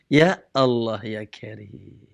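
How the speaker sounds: low invocation, trailing off very softly; exasperated sigh. Real, not acted. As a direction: sigh it out low, fading away. Exasperated aside at others' excuse. (keluhan lirih bernada rendah)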